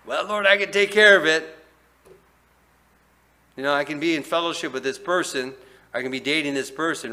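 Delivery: high, loud breezy confidence; mock self-assurance. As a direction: brush God off like you've got it handled. Voicing a self-assured believer.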